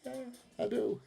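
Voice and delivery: very high squeaky falsetto yeahs; mock smug reply. Replying to amazed drivers in a squeaky high falsetto. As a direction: chirp the yeahs in a tiny high voice. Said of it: "And I go".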